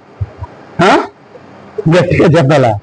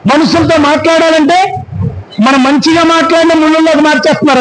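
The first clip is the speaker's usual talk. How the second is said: high, rousing pulpit preaching; fiery preaching; 6 semitones higher than usual. Acted. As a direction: preach it to the whole church. Preaches to the congregation. (ఉత్సాహంగా బోధించే స్వరం)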